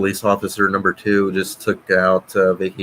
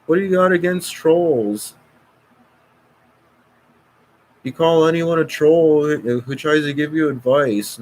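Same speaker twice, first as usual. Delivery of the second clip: high, hammed-up whiny troll; mocking troll voice; 8 semitones higher, 4 dB louder than usual. Mocking the trolls who say he blocks everyone. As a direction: sneer it back at the trolls, pitched up. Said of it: "And they say".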